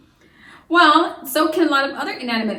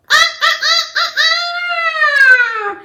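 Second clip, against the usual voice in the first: high loud mock crowing with a laugh; rooster crow; 8 dB louder than usual. Shown as a demonstration. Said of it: "roosters like to crow".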